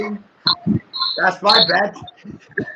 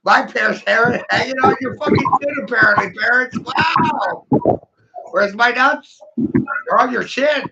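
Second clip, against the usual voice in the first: excited taunting; 6 semitones higher than usual. Acted. Mocking listeners about his nuts, gleeful high jeers. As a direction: crow over them like a winner.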